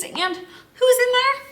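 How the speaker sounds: a gasp of wonder at what's hiding there; playful surprise. Acted. Surprise at big leaf pile.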